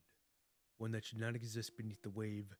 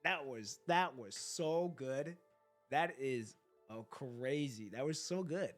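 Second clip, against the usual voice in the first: hyped; 3 semitones lower, 7 dB louder, slower than usual. Real, not acted. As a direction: rave about it. Hyped reaction to ending.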